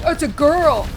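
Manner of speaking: loud mock surprise at a girl; imitating random player. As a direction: put on an excited stranger's voice. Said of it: "where I was like".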